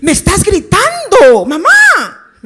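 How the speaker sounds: loud, high mock wail of protest; hypersensitive child. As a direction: overreact like a fragile kid being yelled at. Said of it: "Le hablan un poquito fuerte". (niño ofendido, queja exagerada a gritos)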